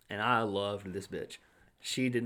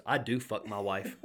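laughing retold retort; slower than usual. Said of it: "and he goes".

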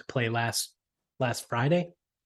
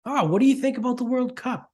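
high, prying mock question; hammed-up soccer busybody; mock stranger; 9 semitones higher than usual. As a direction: ape the guy's eager small talk, pitched way up. Mocking the stranger.

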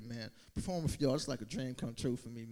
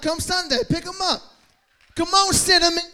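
calling out; 18 dB louder than usual. Real, not acted. Calling dogs loudly.

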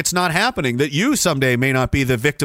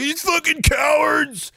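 high, slow mocking yell; hammed-up shouted insult; mock critic shout; 9 semitones higher, slower than usual. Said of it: "the guys that'll be like".

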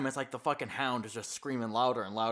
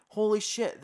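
mock surprise; 6 semitones higher, 4 dB louder than usual. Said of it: "And they're like".

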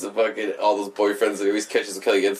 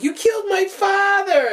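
high, drawn-out imitated girl; mock horrified accusation. Girl accuses the man who knocked out father, mock wail. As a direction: wail it as a hammed-up daughter.

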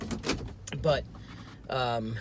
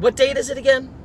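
high, loud feigned innocence; mock innocent; 12 semitones higher, 9 dB louder than usual. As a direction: ask like you suspect nothing. Feigned innocent question setting trap.

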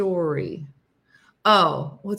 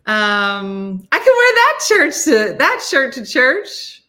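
excited; 4 semitones higher, 9 dB louder, slower than usual. Real, not acted. Excited about the bacon shirt.

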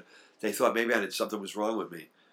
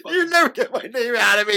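laughing tease; 7 dB louder than usual. Real, not acted.

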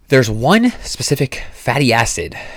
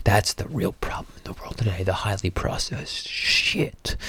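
whispering; 10 dB quieter than usual. Acted. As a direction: lean in and whisper it. Drops to whisper for emphasis.

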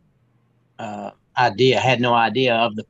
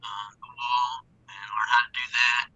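ordinary talk, nothing marked

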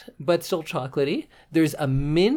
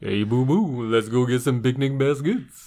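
Yogi Bear. Acted. Cartoon bear to his pal about picnic baskets, goofy put-on voice. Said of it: "Can you do a Yogi Bear impression".

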